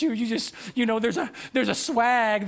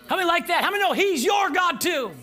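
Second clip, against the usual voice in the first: rallying shout; 7 semitones higher, 4 dB louder than usual. Real, not acted. Preacher rallies the congregation.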